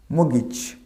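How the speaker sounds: মগিজ with no t, "mo-gich"; corrected pronunciation. Shown as a demonstration. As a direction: say মগিজ with a silent t and short "gi". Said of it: "এর উচ্চারণটা বেশ confusing". (সঠিক মগিজ, "ট" ছাড়া)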